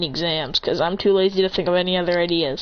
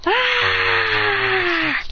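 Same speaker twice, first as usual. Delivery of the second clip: long, high-pitched yell; sustained anime transformation scream; power-up scream; 12 semitones higher than usual. Acted. Character powering up.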